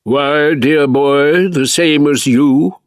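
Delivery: lightly voiced grand old actor; a hint of a plummy theatrical luvvie; Sir Michael Hordern impression. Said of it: "came Sir Michael's reply".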